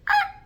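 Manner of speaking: very high, loud yelp; imitated dog yelp. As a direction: yelp back like the dog answering. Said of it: "I heard".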